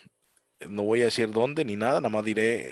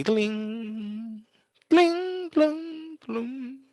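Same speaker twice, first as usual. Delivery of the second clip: very high tinkling notes; piano plink imitation; 11 semitones higher, much slower than usual. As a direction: ring out piano keys.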